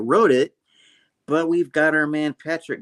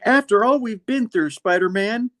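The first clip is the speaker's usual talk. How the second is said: a higher, louder mock pal voice; high mock Johnny voice; 10 semitones higher, 3 dB louder than usual. Retelling the comic, voicing Johnny to Peter high and mock-emotional. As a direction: pitch way up, hammed-up buddy plea.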